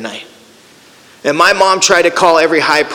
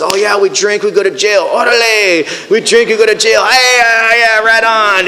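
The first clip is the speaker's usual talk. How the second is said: higher, whooping camaraderie; boisterous acted-out celebration; rowdy group cheering; 6 semitones higher than usual. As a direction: act out a gang of pals hollering agreement. Group whooping over shared jail stories.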